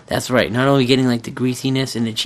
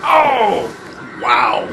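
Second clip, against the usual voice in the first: startled shout; 3 dB louder than usual. Real, not acted. Watching a movie's sudden scene, startled shout. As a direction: cry out in amazed shock.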